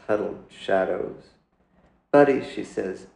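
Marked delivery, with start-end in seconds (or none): 2.1-2.4 higher, louder call of his name. Acted. Mother calling her sulking son, loud and high. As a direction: cut through his silence with his name.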